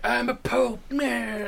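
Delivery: high, pained mock pope; wounded pope. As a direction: whine it like a shot pope.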